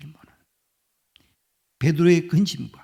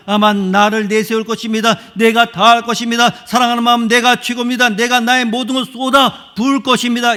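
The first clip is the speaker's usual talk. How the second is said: loud, high, puffed-up bragging; boastful old Peter; 6 semitones higher, 9 dB louder than usual. Retold or imitated. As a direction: show off your own zeal. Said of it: "과거의 베드로라고 하면 어떻습니까". (과거의 베드로처럼 큰소리로 자랑하며)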